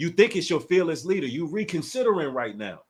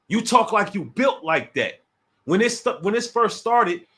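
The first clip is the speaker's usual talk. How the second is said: ordinary talk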